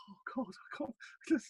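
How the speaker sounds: a deflated, mortified murmur. Retold or imitated. [name] after being snubbed by [name], mortified and soft. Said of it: "red face, going".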